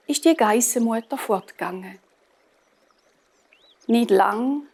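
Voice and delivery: ordinary delivery, nothing marked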